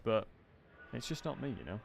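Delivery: ordinary talk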